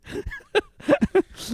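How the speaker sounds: high squealing laugh; shrieking laugh. Real, not acted. Laughing at herself for falling for the pitch, high squeal. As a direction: burst into a high giggle. Laughs at her own gullibility.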